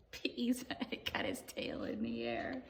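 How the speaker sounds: whisper. Acted. Hushed reading.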